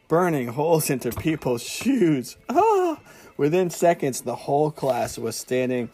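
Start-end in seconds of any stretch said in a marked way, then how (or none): 2.5-3.0 sudden high loud yelp; a sharp cry of alarm; shocked yelp as the potion spreads; startled cry. A narrator's reading. Cauldron melts, burning shoes.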